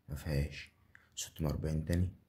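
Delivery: ordinary delivery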